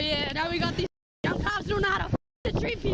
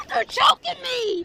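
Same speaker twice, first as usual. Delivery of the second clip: loud, slow, desperate cry; terrified shout; 2 semitones higher, 6 dB louder, much slower than usual. Real, not acted. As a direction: yell for help in fear. Woman restrained, crying for help.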